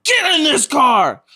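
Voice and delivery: high, drawn-out panicked yell; retold scream. Screaming at a fleeing friend in a haunted spot, retold panicked scream. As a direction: scream the order slowly at full volume, re-enacting it. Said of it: "Now I screamed. I said".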